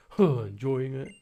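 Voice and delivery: lightly voiced sulky teen boy; a hint of a moody teenager scolding; angsty teen boy Gladion. Rival challenging the player, lightly voiced sulky teen. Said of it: "it's angsty teen boy Gladion".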